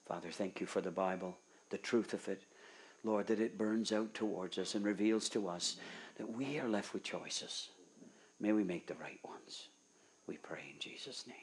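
soft, hushed closing prayer. Acted. Preacher praying over the congregation at the end, soft prayer. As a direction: pray quietly for the congregation.

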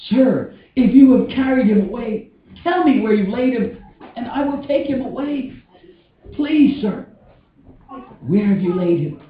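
high, thin-voiced acted entreaty; desperate polite plea, falling away at the end; pleading. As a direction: beg a stranger for help, pitch climbing. Mary pleading with supposed gardener.

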